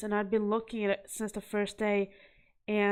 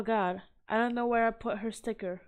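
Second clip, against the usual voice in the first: ordinary talk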